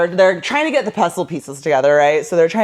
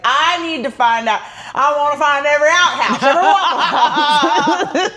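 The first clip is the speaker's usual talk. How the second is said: hammed-up intense cop, laughing through it; The Rock, laughing; 5 semitones higher than usual. Rock barking orders at his team, laughing. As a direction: demand it all while cracking up. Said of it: "The Rock is like".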